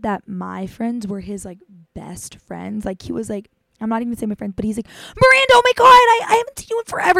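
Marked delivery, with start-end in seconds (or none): 4.9-7.2 much higher, loud gushing squeal; overexcited fake friend. Stranger greeting her friend Miranda, a loud high gushing squeal. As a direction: gush like you've known her for years.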